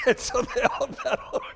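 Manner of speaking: laughing loudly through the words. Real, not acted. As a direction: giggle out the punchline. Laughing through the rescue story.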